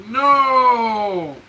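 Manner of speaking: long drawn-out cry of disbelief; dismayed no. Real, not acted. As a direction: stretch it out in real dismay. Reacts to friend's harassment news.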